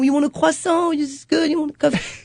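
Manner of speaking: high-pitched little barista voice; imitated young son. Mother imitating her son serving customers, high child voice. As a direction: play a small child hurrying customers along. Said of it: "That kid is six years old. He's, he's like".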